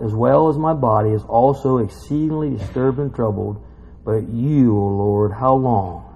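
low, measured psalm reading; solemn scripture reading. Acted. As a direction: read the lament out gravely. Reads psalm aloud.

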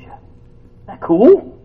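high, drawn-out cool; delighted. Real, not acted. Preacher marveling to his listeners, high and drawn out.

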